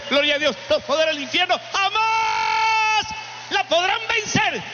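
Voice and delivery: building to a high, shouted proclamation; rousing preaching. Real, not acted. Preacher proclaiming the Church's victory to the faithful, fervent shout. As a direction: preach at full force, pitch climbing, key words held long.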